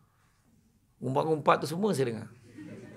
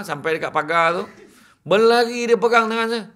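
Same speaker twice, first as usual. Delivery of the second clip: normal talking voice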